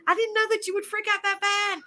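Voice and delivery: very high-pitched imitation; mock apology. Husband excusing himself to his frightened wife, high mocking imitation. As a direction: put on a squeaky-high teasing quote. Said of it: "he said".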